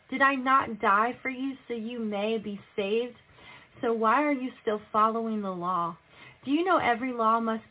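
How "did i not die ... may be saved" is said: ordinary delivery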